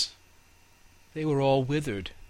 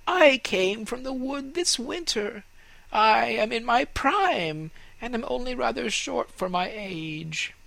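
proud fir tree; 11 semitones higher than usual. Acted. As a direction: speak as the vain young fir.

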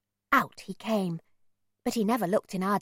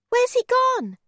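anxious high question; 7 dB louder than usual. A narrator's reading. Boy loses sight of man.

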